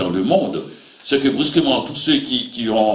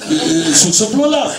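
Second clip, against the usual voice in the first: higher and laughing, slowing at the end; 8 semitones higher than usual. Real, not acted. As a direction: let the irony bubble into laughter. Ironic point about crime fiction.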